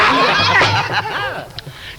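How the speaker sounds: a high burst of laughter, falling away. Real, not acted. Preacher laughs at his own joke about the son, high laugh.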